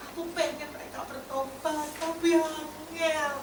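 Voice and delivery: soft, slow, weepy prayer of surrender; a soft, slow, weepy voice breaking into surrender; tearful prayer. Retold or imitated. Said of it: "Dia menangis".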